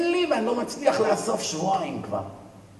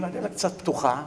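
ordinary delivery

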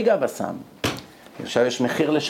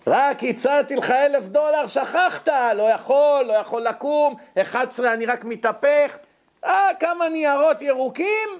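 mocking; 8 semitones higher than usual. Mocking the man's sudden eagerness.

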